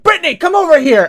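high, loud, hurried call. Retold or imitated. Barber staff calling Brittany over to see, excited call. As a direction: summon a colleague excitedly from across the salon.

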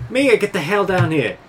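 yelling. Acted. Player's character calling a missing crewmate, yelling. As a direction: call out sharply for someone downstairs. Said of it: "I'm just gonna yell out".

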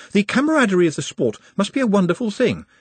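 high-pitched, earnest admiration; chattering Nutt. A narrator's reading. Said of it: "while Nutt chattered".

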